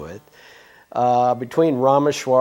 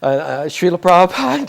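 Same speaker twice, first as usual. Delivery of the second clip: hesitant, stumbling start, pitched up and louder; a flustered student stumbling over his teacher's name; nervous stammer; 7 semitones higher, 7 dB louder than usual. Retold or imitated. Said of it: "So I kinda stammer".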